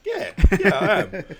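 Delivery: very high, loud laughing yes; happy laughing. Retold or imitated. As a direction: burst out like a happy Labrador. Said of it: "He's like".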